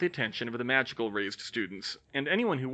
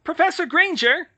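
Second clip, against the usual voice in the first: very high squeaky little professor; squeaky Flitwick; 10 dB louder than usual. Acted. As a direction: squeak out her name. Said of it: "Flitwick's squeaky voice".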